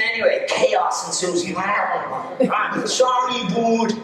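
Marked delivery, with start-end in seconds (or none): 1.6-3.9 put-on drunk Dubliner's apology, pitched up; inner city Dublin accent. Drunk Alan bumping through the pizzeria, inner city Dublin accent. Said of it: "gets a little inner city Dublin accent".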